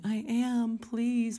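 high-pitched mock tearful pleading; imitated crying plea. Woman begging the preacher to get saved, imitated crying. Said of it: "And she's crying".

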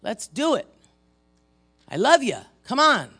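loud, high rallying call; punchy, insistent encouragement; God urging her on. Retold or imitated. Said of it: "God says".

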